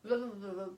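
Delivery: mock tongue-tied babble; gibbering. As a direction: imitate words turning to mush. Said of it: "I was a gibbering mess".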